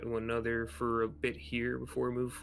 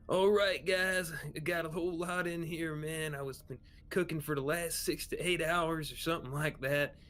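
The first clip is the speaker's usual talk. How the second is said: higher-pitched, folksy cook voice; hearty cook character; 7 semitones higher, 3 dB louder than usual. Acted. Cook serving food to the hungry crew, hearty and high-pitched. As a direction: call the crew to dig in, higher and lively.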